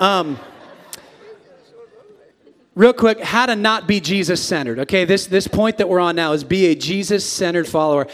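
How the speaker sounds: ordinary talk, nothing marked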